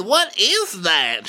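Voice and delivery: high, drawn-out mock query; acted question. Imitating a cast member reacting to Baccarat, exaggerated query. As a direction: stretch it out high like a put-on diva. Acting out a character's line.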